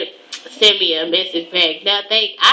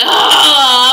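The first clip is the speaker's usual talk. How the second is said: shocked gasp; 4 semitones higher, 8 dB louder than usual. Real, not acted. Gasps at being blown off.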